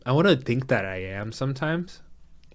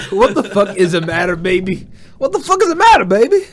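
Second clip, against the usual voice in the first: high, loud replay of the question, building to a yell; aggressive; 9 semitones higher, 12 dB louder than usual. Retold or imitated. Co-host replaying his own reaction to the joke, aggressive shouting. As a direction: blurt it louder and higher, ending in an aggressive shout. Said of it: "I said that so aggressively too".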